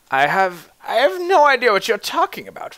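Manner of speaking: scared denial. Acted. Old man lying, scared.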